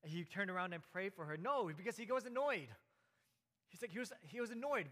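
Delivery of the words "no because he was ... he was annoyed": higher, louder, pressing the point home; no, he really was annoyed, driving it in; emphatic insistence. Real, not acted. Preacher telling the congregation Paul was annoyed, emphatic and louder.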